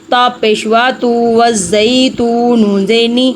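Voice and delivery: ordinary delivery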